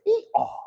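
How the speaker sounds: high squeaky hee-haw, a mock donkey bray. How the donkey got his voice, a high mock bray. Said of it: "how the donkey got his voice".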